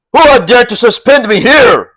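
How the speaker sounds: loud, high angry shout from a captive. A narrator's reading. Bound Lin Chong shouts at his captors, loud and high. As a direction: bellow it at whoever strung you up. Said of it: "he shouted".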